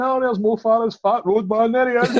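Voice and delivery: high, slow taunting fan voice; mocking fans. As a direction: jeer it like a mocking online fan. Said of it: "[name]'s fans saying".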